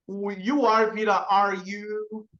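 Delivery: usual speaking voice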